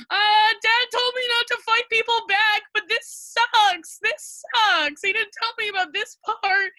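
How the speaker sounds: put-on a kid wailing that it's unfair; whiny distress. Being beaten, complaining helplessly.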